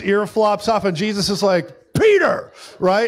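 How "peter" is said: high, loud mock scolding call of the name; rebuking call. Jesus stopping Peter mid-swing, high loud mock rebuke. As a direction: call him out like a startled master.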